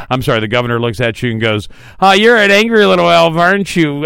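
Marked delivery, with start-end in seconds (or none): 2.0-4.1 imitated teasing put-down, higher and louder; mock taunt. Host retelling the governor's comeback to a heckler, mock taunt. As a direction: playfully needle him, pitched up and punchy.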